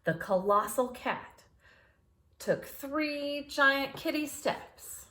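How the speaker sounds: ordinary delivery, nothing marked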